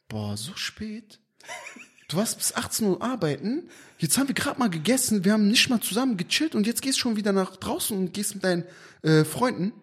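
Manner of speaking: soft, whiny complaining wife. Retold or imitated. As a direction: complain softly like a nagging wife. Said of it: "Du sagst".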